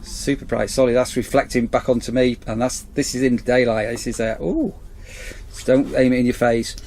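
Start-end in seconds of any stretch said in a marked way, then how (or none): none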